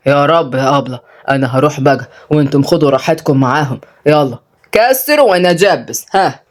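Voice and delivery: low male voice; village father. Acted. Father leaves the children to their teachers, low. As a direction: drop low, hand over with authority.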